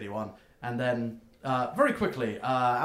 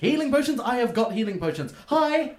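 much higher, eager question; chirpy gnome; 9 semitones higher, 3 dB louder than usual. Acted. Gnome checking the order with the customer, squeaky high. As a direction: ask it like a delighted shopkeeper.

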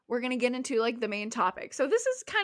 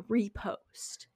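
whisper; 4 dB quieter than usual. Retold or imitated. Whispered punchline of a quoted TikTok.

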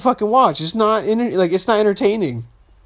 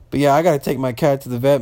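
ordinary talk, nothing marked

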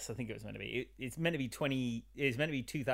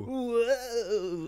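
hillbilly whoa; 11 semitones higher, 8 dB louder than usual. Pretend. Hillbilly banter continues.

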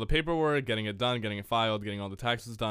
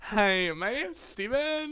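exaggerated high, slow, drawn-out voice; mocking Steven impression; 12 semitones higher, much slower than usual. Podcaster teasing co-host about boyfriend's voice, mocking impression. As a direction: put on a silly high-pitched introduction. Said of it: "He definitely does not talk like that".

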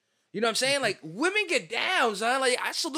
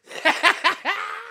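high squealing laugh; 12 semitones higher, 6 dB louder than usual. Real, not acted. Shrill laugh.

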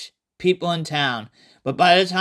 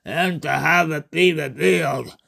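slow, low gloating monster voice; gloating Cthulhu; 3 semitones lower, slower than usual. Acted. Cthulhu gloating to the fooled savior, slow and low. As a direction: savor the betrayal, sinking to a low finish.

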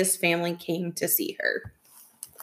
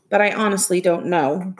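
ordinary delivery, nothing marked

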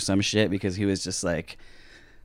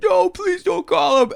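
very high, slow begging; put-on squeal of desperation; homeless man impression; 3 dB louder, slower than usual. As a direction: plead in a mock wail. Homeless man pleads, imitated.

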